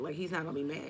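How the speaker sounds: ordinary talk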